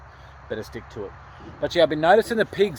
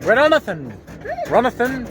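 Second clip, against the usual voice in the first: calling the dog; 8 semitones higher than usual. Shown as a demonstration. Calling the dog by mixed names.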